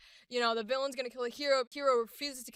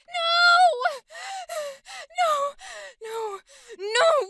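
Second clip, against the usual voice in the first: high, full-force anguished screams; devastated screaming no; 9 dB louder, much slower than usual. Acted. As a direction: scream it like your world just ended. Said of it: "So let's try it".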